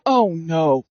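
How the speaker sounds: low, sinking "oh no"; understated dismay, pitched right down; retold dread. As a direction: drop the voice low in mock dread.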